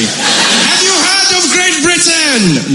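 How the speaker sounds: high, slow, drawn-out royal proclamation; hammed-up town crier; pompous herald. British arriving in India announce themselves, high slow herald cry. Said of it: "you don't need to shout".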